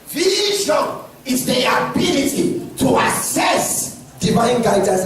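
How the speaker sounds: "Vision" stretched out high and slow; emphatic stress. Real, not acted. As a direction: punch "ability" and "access" separately, with space between them. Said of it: "I'm emphasizing it".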